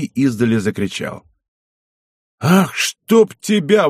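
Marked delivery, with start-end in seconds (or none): 2.4-3.9 high, drawn-out cry of amazement; amazed exclamation. A narrator's reading. Zych riding up to the felled bison, amazed exclamation. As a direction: exclaim it slowly, pitched well up. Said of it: "воскликнул Зых".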